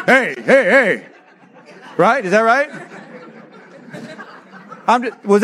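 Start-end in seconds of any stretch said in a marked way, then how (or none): 0.1-1.1 slow, drawn-out teasing "hey, hey, hey" through a laugh; mock schoolyard taunt. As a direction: tease a classmate, half laughing.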